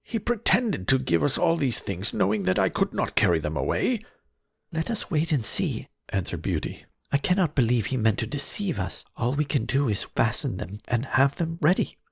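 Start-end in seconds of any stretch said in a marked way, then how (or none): 0.0-4.0 much higher, aggrieved cry; a father who feels cheated; indignant merchant. Acted. Merchant complains to Beauty, high and aggrieved. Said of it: "cried the merchant". 4.7-5.9 put-on much higher gentle girl's voice; calm Beauty. Beauty calms her father, high and gentle. 7.1-12.0 put-on much higher gentle girl's voice; calm Beauty. Beauty calms her father, high and gentle.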